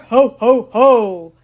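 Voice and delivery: booming loud Santa laugh; Santa's roar. A narrator's reading. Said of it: "Santa roared".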